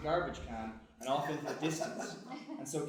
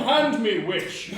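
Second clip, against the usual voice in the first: loud, high, haughty demand; hammed-up indignant shout; indignant cat; 7 semitones higher, 16 dB louder, slower than usual. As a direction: cry out in outraged protest. Cat protests being scooped up.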